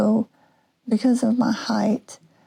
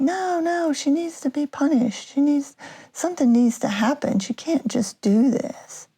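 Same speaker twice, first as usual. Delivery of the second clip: higher mock-whiny protest from an upset older brother; hammed-up brother complaining, pitched higher; imitating whiny brother; 6 semitones higher than usual. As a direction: pitch it up like a sulking kid demanding punishment. Brother's protest voiced in story.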